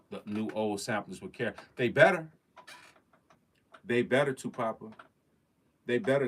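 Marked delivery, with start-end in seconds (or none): 1.8-6.3 emphatic warning. Real, not acted. Vlogger warning gear makers, insistent and loud. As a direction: warn them with a raised voice.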